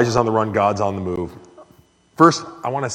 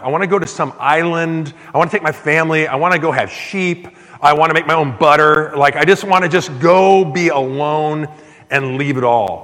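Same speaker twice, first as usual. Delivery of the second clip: raised, louder yearning list of escape dreams; longing to escape; 5 semitones higher, 5 dB louder than usual. Retold or imitated. Preacher dreaming aloud of fleeing to an island, eager and raised. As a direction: let each wish climb with eager longing.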